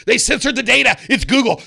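hammed-up suspicion, slamming the words out; conspiracy outburst. Investor blaming Google for hiding the news, mock indignant. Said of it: "And you're like".

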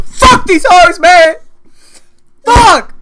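very loud, high-pitched shout; explosive mock-frustrated holler; yelled outburst. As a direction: blow up and yell it out. Sudden outburst after being teased.

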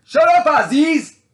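high, drawn-out furious yell; sudden full-force shout, far above talking pitch; shouted rebuke. Real, not acted. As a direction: scream it slowly at an unseen tormentor.

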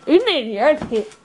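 usual speaking voice

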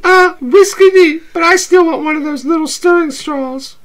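playful date voice, high and bright; high girly voice. Acted. S0 holds Abby voice.

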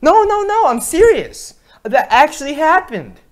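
loud, high-pitched indignant insisting; insistent protest. Acted. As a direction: yell it, desperate to be believed. Insists his tall tale is true.

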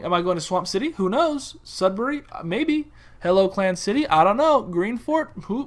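normal talking voice

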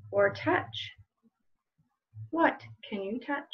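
slow, gentle picture-book read-aloud with long pauses; reading to children. Acted. Librarian reading a picture book to children at home, slow storytelling voice. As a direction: let each question hang for little listeners.

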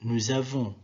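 "Nous avons" joined by a Z, falling; liaison. Shown as a demonstration. Teacher repeating the example, liaison Z in "Nous avons". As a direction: sound a Z between "Nous" and "avons". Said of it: "il y a une liaison".

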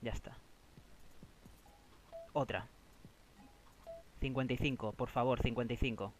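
soft, tense repeated "por favor"; quiet pleading. Real, not acted. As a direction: plead under your breath with the machine. Begging the slot for a number.

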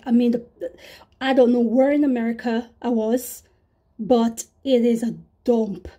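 ordinary talk, nothing marked

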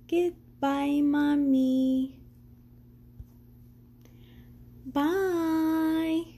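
little Elmo calling goodbye to Mommy; Elmo saying goodbye. Acted. Elmo saying goodbye to Mommy as he leaves, little-monster voice. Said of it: "as he says goodbye".

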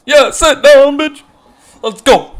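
high, loud trash talk; real taunting yell; taunting shout. Real, not acted. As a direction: yell it at the struck-out batter. Taunting after strikeout.

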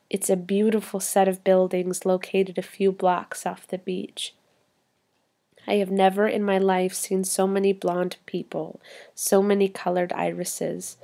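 usual speaking voice

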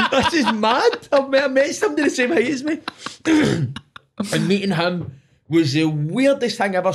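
high-pitched laughing amazement; laughing disbelief. Real, not acted. Recalling meeting [name], laughing and high-pitched.

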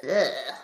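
low, loud yuck groan; mock-disgusted grunt; playful disgust. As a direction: groan as if grossed out by bugs. Disgust at frogs eating insects.

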